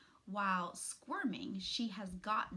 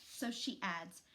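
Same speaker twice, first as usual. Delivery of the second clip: ordinary delivery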